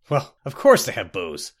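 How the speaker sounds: lightly snarled retort; irritated snarl. A narrator's reading. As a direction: snap back at a foolish remark. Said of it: "snarled Captain Balfix".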